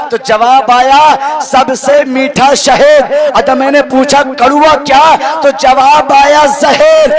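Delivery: rally the faithful, ringing out each address; fiery preaching. Acted. Preacher calling to the young men of the community, rousing sermon.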